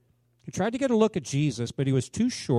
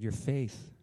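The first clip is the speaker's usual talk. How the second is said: low, much softer, gentle voice of Jesus; tender blessing; 8 semitones lower, 10 dB quieter than usual. Acted. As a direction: speak healing words gently.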